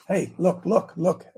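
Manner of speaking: normal talking voice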